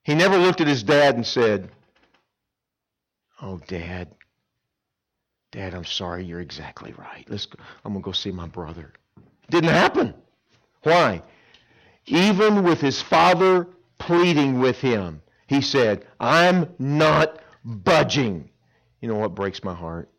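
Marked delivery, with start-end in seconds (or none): 3.4-8.9 soft, low, penitent plea to his father; hushed repentant son; quiet humble apology. Pretend. Son's imagined humble apology. 9.5-15.2 raised, slowed, higher preaching voice; loud pulpit emphasis. Real, not acted. Preacher building intensity. 16.2-18.5 slow, loud, higher defiant refusal; defiant stubborn son. Acted.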